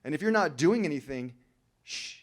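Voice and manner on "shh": hush. Acted. Hushing the room.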